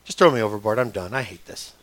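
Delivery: low, dejected giving-up voice; resigned despair. Acted. Jonah to the sailors in the storm, dejected and resigned.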